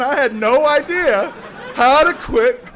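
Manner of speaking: high, loud, slow, laughing through it. Real, not acted. Laughing about not knowing how.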